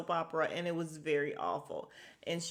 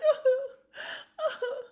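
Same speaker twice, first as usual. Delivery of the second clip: shrill laugh with a mock sob in it; high cackling laugh; 6 dB louder than usual. Real, not acted. Laughing at how fast Rinna snapped out of it, high laugh. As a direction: burst out laughing, pitched way up.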